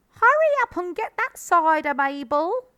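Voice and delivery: high, loud woman's call. A narrator's reading. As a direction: holler to someone elsewhere in the house. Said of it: "the voice called".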